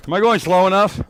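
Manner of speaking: ordinary delivery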